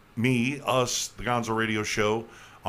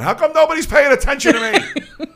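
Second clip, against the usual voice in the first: high, loud whiny mock voice; whiny imitation; 12 dB louder than usual. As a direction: whine like a neglected kid. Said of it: "Richie's on there going".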